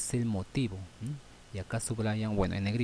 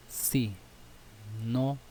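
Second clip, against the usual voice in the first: "si no" as two words with a pause between; teacher spacing out "si" and "no"; separated words. Shown as a demonstration. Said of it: "por separado".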